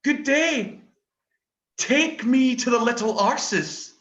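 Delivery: loud high polite plea, near laughing; a shouted polite request, with a laugh breaking in; mock polite request, shouted. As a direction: beg the driver loudly while cracking up. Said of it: "I've been saying to the bus driver".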